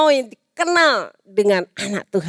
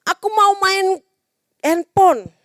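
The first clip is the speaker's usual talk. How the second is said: put-on whiny child; 10 semitones higher than usual. Child voiced wanting phone.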